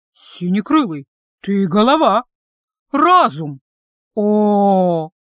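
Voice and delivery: emphatic, awed exclamations; admiring. A narrator's reading. Gargoyle praising her friend's clever answer, admiringly. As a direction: proclaim each compliment with delighted admiration. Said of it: "и восхищённо ... выдала".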